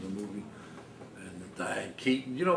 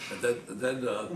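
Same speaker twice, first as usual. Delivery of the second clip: ordinary talk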